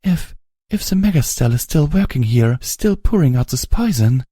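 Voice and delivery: high, halting, trembling. A narrator's reading. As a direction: stammer through the fear. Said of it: "her trembling voice".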